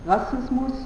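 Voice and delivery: normal talking voice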